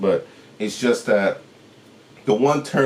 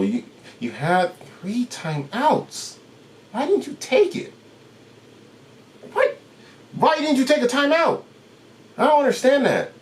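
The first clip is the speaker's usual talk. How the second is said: irritated disbelief. Real, not acted. Vlogger venting at the coach about unused timeouts, high baffled irritation. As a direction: still can't believe he didn't call it. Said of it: "I'm mad right now".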